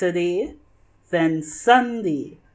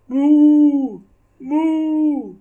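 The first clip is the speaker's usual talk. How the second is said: loud, higher mooing cow; 9 semitones higher, 7 dB louder than usual. Acted. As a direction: moo out like a big cow. Animals answer in the fog.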